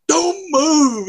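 a mock stern order from a mad cop. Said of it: "And he's mad".

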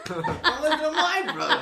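squeaky imitation of a Boston radio host; Boston accent. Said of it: "car talk".

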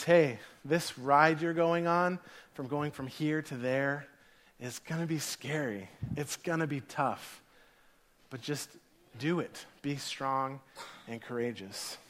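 soft, low, measured speech as God; gentle reassurance. Retold or imitated. God sending someone off on a scary ride, quiet reassurance. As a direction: drop it softly and slowly, steadying the listener.

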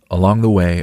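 ordinary delivery, nothing marked

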